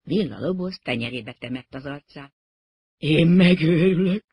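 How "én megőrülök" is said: loud, slow wail of despair; like a general who has lost half his army; devastated. A narrator's reading. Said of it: "mondta olyan hangon, mintha elveszítette volna".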